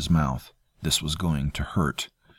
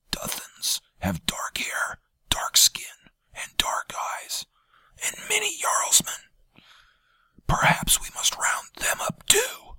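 strained, rasping whisper; hoarse whisper; 4 dB quieter than usual. A narrator's reading. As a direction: barely get the words out. Janus whispering accusation.

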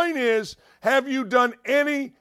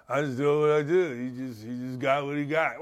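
low, soft mumbled athlete imitation; mock mumbling; 7 semitones lower, 4 dB quieter, faster than usual. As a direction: mumble it low, trailing into near silence. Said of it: "show this mumbling, stumbling".

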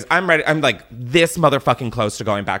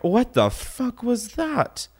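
high, slow mock outrage; incredulous; 11 semitones higher, slower than usual. Ranter reacting to being ghosted, incredulous. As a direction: draw it out in high-pitched disbelief.

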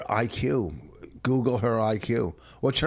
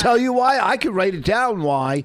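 high, raised-voice challenge; exasperated shouting; 11 semitones higher, 7 dB louder than usual. Real, not acted. Husband offering wife proof he knows, exasperated shout. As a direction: argue loudly, refusing to back down.

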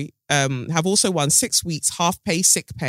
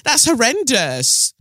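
appalled disbelief; 10 semitones higher, 4 dB louder, slower than usual. Real, not acted. Shocked at the old wage.

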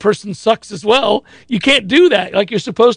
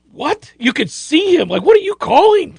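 appalled official; 9 semitones higher than usual. Retold or imitated. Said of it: "looked over appalled".

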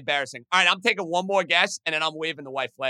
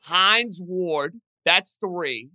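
normal talking voice

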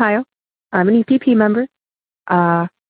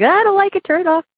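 scared fiance; 12 semitones higher than usual. Retold or imitated. Scared fiance begging to stop show.

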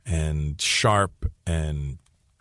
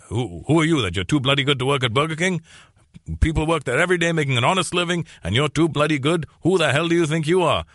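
higher-pitched indignant father, imitated; mock parental scolding, pitched up; scolding dad; 7 semitones higher than usual. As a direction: act out an outraged dad telling off his son. Acts out dad scolding him.

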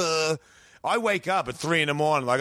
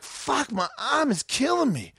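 pained groan; 9 semitones higher, slower than usual. Retold or imitated. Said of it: "And I'm like".

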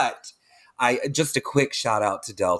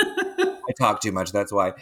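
laughing; 5 semitones lower than usual. Real, not acted. Laughs at herself.

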